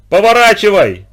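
loud, high urgent shout; yelling. A narrator's reading. Narrator to Mike as collision looms, yelling. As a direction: yell a desperate order across the deck. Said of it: "заорал я".

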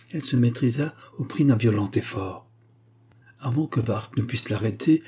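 ordinary delivery

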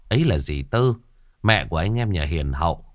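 usual speaking voice

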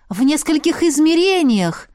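sudden realization; realizing. Acted. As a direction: it finally clicks. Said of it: "дошло до меня".

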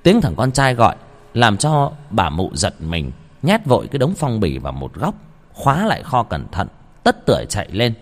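ordinary delivery

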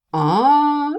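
high, loud, drawn-out aha; a gloating, rising "ah"; pleased smirk. A narrator's reading. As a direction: crow it with a smug grin. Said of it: "довольно ухмыльнулся Вик".

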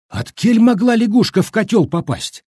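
high-pitched, pressing demand; insistent questioning. A narrator's reading. Brigade leader probing how it happened, insistent. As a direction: press hard for an answer. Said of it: "допытывался Любишкин".

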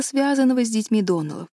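plain reading voice

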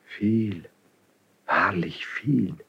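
slow, whispered wonder; awed whisper. Acted. Prince whispers in awe at the king's favour. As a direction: breathe it in quiet amazement.

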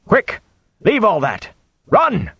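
urgent high-pitched orders; shouting. A narrator's reading. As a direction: yell short commands to flee. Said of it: "Tom shouted".

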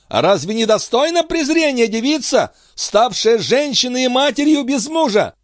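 high, pained agitation; agitated. A narrator's reading. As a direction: work yourself up through the pain. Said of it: "приходя всё в большее возбуждение от собственной боли". (взволнованно, высоким голосом)

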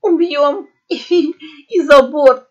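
high, broken mewing voice; through tears of laughter. A narrator's reading. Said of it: "сквозь слёзы мявкнула кошка". (мявканье сквозь слёзы смеха)

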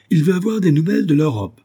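high, loud incredulous cry; exclaiming. A narrator's reading. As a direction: throw the request back in scornful disbelief. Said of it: "s'écria-t-il".

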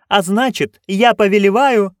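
high, loud royal order; imperious duchess command. Acted.

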